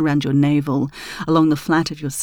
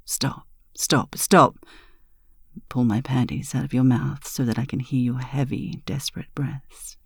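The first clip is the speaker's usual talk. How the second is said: ordinary delivery, nothing marked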